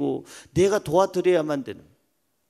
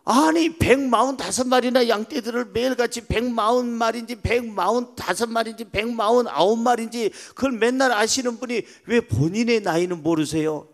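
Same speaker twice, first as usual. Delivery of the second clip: higher-pitched astonished questioner; incredulous young man; 8 semitones higher, 4 dB louder than usual. Acted. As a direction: act out a young man pressing an old shepherd in disbelief. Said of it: "젊은이가 와서".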